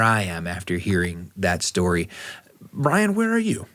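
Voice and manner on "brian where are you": normal talking voice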